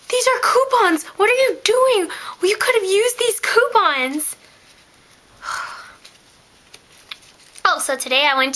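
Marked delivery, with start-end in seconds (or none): 0.1-4.4 mock shock. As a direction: ham up the outrage over coupons. Mock outrage at coupons.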